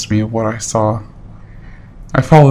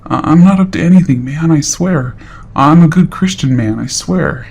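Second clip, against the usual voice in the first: higher, stammering, pleading denial; nervous, higher pleading stammer; 5 semitones higher than usual. Retold or imitated. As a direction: play the scared liar caught out, voice up high. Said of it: "In a really nervous voice".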